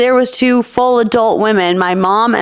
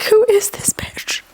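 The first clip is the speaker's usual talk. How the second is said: breathy whispered aside; whispering; 11 semitones higher, 3 dB quieter than usual. Retold or imitated. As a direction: whisper it like a catty thought. Retold catty inner thought.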